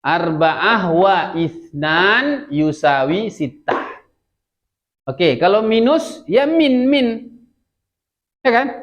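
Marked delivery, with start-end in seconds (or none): 0.0-4.0 very slow, stretched-out Arabic sentence; model reading. Shown as a demonstration. Teacher repeating the whole sum for students, slow model reading. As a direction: recite the sum slowly, word by word. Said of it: "bacanya yusawi".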